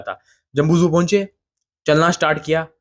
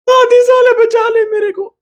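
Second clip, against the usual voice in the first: high, slow, desperate squeal; much higher comic wail of panic; mock panic plea; 4 dB louder, slower than usual. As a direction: beg your friend to save you, hammed up. Begging friend to save him.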